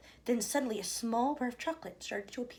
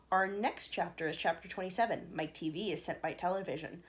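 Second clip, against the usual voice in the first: ordinary delivery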